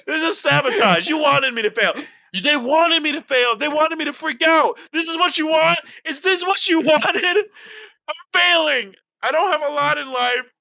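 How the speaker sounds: high-pitched loud comic tirade; mad, ranting meltdown. Real, not acted. Losing player accuses others of sabotage, loud high meltdown. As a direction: wail in outrage, easing down by the end. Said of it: "I'm so mad".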